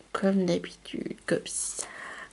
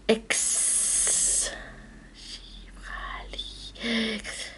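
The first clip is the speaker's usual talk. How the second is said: awed whisper; 10 dB quieter, much slower than usual. Real, not acted. Whispering card name in awe.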